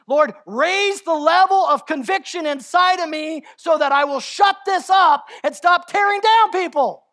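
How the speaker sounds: loud, high, pleading prayer cry; preacher's prayer rising to a shout; fervent shouted prayer. Acted. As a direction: cry out to the Lord with everything. Prays aloud at full force.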